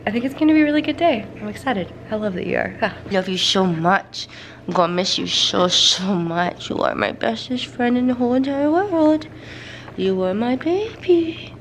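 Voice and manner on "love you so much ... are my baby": tearful goodbye. Real, not acted. Saying goodbye to her dog.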